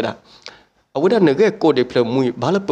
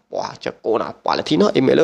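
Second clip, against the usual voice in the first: normal talking voice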